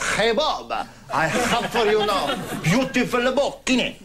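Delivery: a hint of Antonio's Spanish accent, slow. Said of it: "If you start like that".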